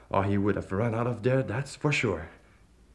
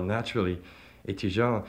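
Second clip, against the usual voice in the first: ordinary delivery, nothing marked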